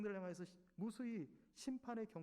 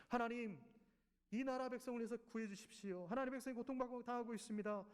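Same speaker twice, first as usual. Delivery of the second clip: raised, earnest cry to God; desperate prophet praying aloud, higher; pleading prayer; 5 semitones higher than usual. Acted. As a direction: beg God for help, voice lifting.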